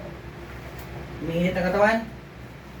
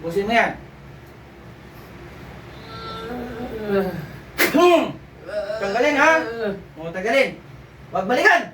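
commanding exorcism; 4 semitones higher than usual. Acted. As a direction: push the spirit out with force. Healer commanding spirits to leave.

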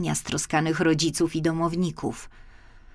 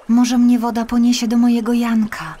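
put-on hopeful girl; 6 semitones higher, 7 dB louder than usual. Marysia hopeful thought.

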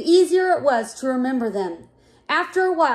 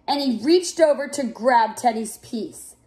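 usual speaking voice

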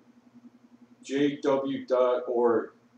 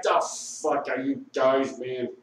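ordinary talk, nothing marked

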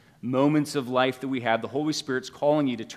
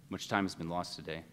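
Aslan speaking; 8 semitones lower, 10 dB quieter than usual. A narrator's reading. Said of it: "Aslan says".

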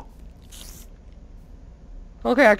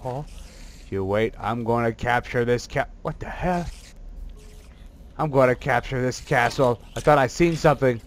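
deep scheming villain; 7 dB quieter than usual. Acted. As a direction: growl out the plan like a cartoon baddie.

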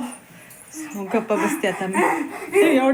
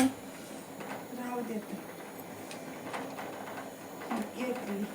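far off; 18 dB quieter, much slower than usual. Real, not acted. Mother far from mic.

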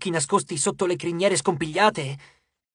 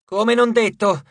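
louder, higher sudden exclamation; exclaimed; 4 semitones higher, 6 dB louder, slower than usual. A narrator's reading. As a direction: blurt it out loud in alarm. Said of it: "esclamò Frank". (esclamazione improvvisa e forte)

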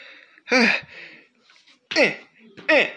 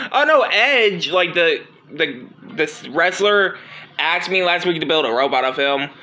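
ordinary delivery

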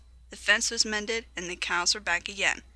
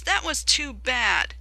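slow, higher, loud moan; groaning complaint; 5 semitones higher, 5 dB louder, slower than usual. Acted. Coaches complaining after a rude bump, groaning. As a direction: groan it out slowly. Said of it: "groaned the coaches".

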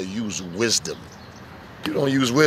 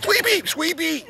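put-on high, drawn-out call for a child; slower than usual. Calling after the crawling baby.

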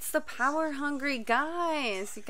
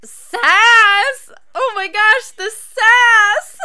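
delighted shout; 9 semitones higher, 14 dB louder, much slower than usual. Real, not acted. Delighted at character's sass.

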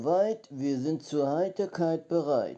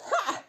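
triumphant high laugh. Real, not acted. Triumphant laugh after trick.

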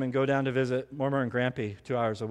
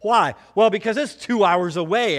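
loud emphatic question; 6 semitones higher, 9 dB louder than usual. Real, not acted. Preacher raising the stakes.

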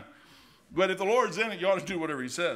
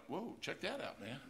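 hushed wonder; 13 dB quieter, slower than usual. Acted. Soft amazement.

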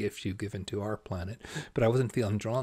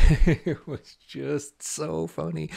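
laughing; 6 semitones higher, 11 dB louder, much slower than usual. Real, not acted. Telling viewers about the play, laughing. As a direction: still laughing, higher and louder, slowed.

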